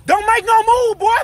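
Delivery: high, loud panicked yelling of whoa; startled shout. Acted. Robber yells at victim not to move, loud panicked shout.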